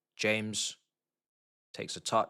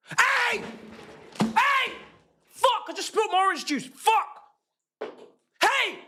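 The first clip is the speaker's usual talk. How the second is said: angry shouting; 9 semitones higher than usual. Real, not acted. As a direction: yell at the screen in outrage. Protesting foul, spilling juice.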